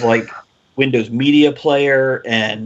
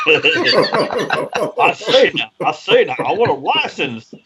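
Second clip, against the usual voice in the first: a hammed-up Southern drawl, half laughing; southern accent; 5 semitones higher, much slower than usual. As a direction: put on a thick Southern accent, playing it up. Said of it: "Use your southern accent".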